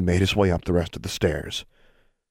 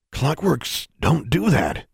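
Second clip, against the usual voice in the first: slow, higher man's voice, shocked; slow puzzled custodian voice; Journey's voice; 8 semitones higher, slower than usual. A narrator's reading. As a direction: voice the custodian, taken aback.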